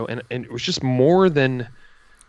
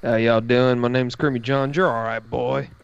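fake Southern accent. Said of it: "This is not our real accents".

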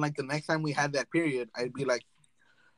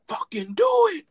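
high, loud egging-on shout; devil on the shoulder; 9 dB louder than usual. Acted. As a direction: urge mischief as a shouting little devil. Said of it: "the devil on your shoulders".

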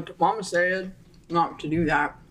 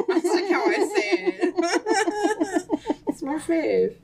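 laughing; 11 semitones higher, much slower than usual. Real, not acted. Laughing at the rhyme.

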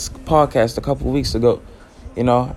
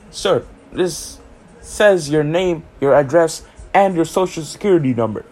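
higher, prim official; mock formal official; 5 semitones higher than usual. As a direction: play the stiff interviewer reading his record. Official confronting him about Twitter.

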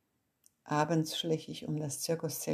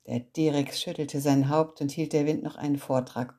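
ordinary delivery

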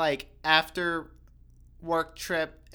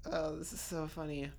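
ordinary talk

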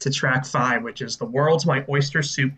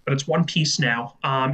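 ordinary talk, nothing marked

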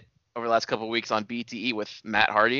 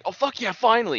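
higher pitched, gushing fan cheer; mock superfan excitement; 9 semitones higher than usual. Friend mocking a superfan reaction, excited. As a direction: act like an overeager fan. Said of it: "super mark where it's like".

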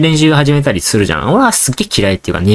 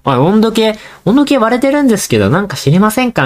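much higher, mock teacher's polite question; teacher asking; 11 semitones higher than usual. Teacher asking the class about the broken thermometer, mock polite. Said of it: "みたいに言われて".

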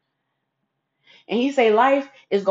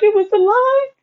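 much higher and louder, a tearful wail; crying wail; 13 semitones higher, 6 dB louder than usual. Retold or imitated. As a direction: blurt it out sobbing. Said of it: "So I'm crying to Mister Bruce".

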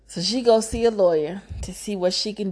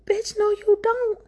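very high indignant squeal; high-pitched, outraged contradiction; indignant retort; 14 semitones higher than usual. Acted. Storyteller rejecting husband's claim to the money, high indignant retort.

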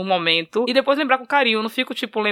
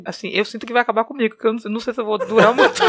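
usual speaking voice

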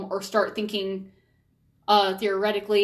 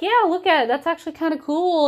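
put-on much higher, bright cheerful pitch to a child; excited; 7 semitones higher, 4 dB louder than usual. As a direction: sell the wound as something fun. Parents pointing wound out to child.

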